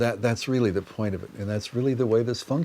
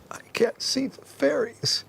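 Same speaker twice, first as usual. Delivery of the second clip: put-on much higher, slow whisper of a small child; imitating a little girl's forlorn whisper. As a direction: whisper it like a tiny girl on the verge of tears. Retelling upset little girl's words.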